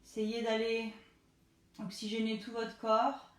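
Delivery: soft, low relaxation coaching; calm them down gently; guided relaxation. Acted. Leads the cool-down breathing.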